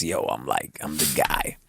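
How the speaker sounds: low, quiet smug mutter of a guy who thinks he made it; lightly mock the full-of-himself guy; low swaggering self-important voice; mock cocky guy. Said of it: "and now the guy thinks".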